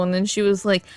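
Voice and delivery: normal talking voice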